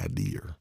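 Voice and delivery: IDEA turned into "idear"; R on the end. Retold or imitated. Questioning what this word is, IDEA with an R. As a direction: finish IDEA with an added R. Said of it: "With the R".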